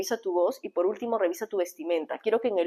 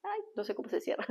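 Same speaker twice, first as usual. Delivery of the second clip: flustered mutter; 11 semitones higher, 11 dB quieter, faster than usual. Real, not acted. Can't close the livestream.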